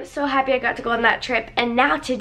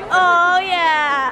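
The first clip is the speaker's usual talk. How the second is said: ordinary talk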